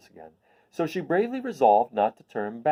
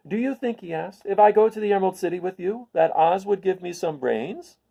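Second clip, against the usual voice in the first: higher, louder hopeful question; high hopeful scarecrow; 7 semitones higher, 4 dB louder than usual. Acted. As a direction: ask eagerly like the straw man. Scarecrow voiced.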